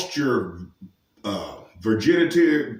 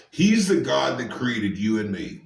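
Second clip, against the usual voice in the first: ordinary talk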